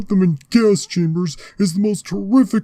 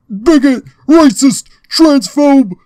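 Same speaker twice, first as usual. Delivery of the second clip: yelling insults, very loud and high; shouting; 7 semitones higher, 7 dB louder than usual. Acted. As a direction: scream each insult out.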